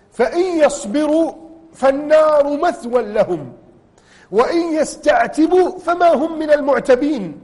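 solemn recited warning; Quran recitation. Acted. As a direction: intone the verse gravely.

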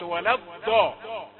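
ولا الضاء with ض as a heavy emphatic dal, said slowly; wrong heavy dal. Shown as a demonstration. Teacher mimicking a student's wrong dal in ولا الضاء. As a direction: stop ض hard like a thick d in ولا الضاء. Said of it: "أنت أتيت بدال مفخمة".

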